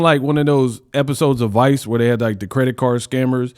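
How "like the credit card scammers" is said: usual speaking voice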